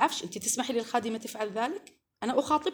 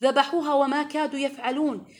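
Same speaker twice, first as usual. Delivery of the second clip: normal talking voice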